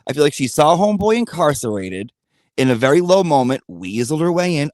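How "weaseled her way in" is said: ordinary talk, nothing marked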